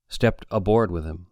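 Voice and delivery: plain reading voice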